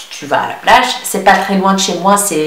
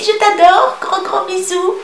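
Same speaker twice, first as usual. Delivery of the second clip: cutesy high; 12 semitones higher than usual. Real, not acted. Sends love to a viewer.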